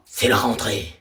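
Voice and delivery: low dramatic doom line; ominous deep voice. Acted. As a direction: drop the voice deep and dark for a grim reveal. Ominous announcement to the kids.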